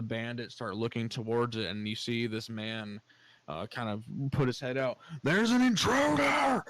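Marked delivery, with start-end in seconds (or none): 5.2-6.6 high, loud, drawn-out yell; screaming alarm. Acted. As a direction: scream the alarm to summon the whole camp. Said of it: "he's kinda screaming, yelling".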